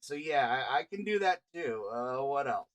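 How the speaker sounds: lazy country elf reading his sheet aloud; southern drawl. Acted. Elf Fallon drawls his skills to the guild group. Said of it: "he said with his unsettling drawl".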